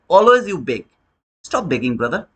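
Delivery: normal talking voice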